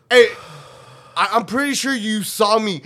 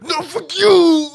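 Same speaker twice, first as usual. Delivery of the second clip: mad retort; 6 semitones higher, 4 dB louder, slower than usual. Retold or imitated. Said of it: "I was mad".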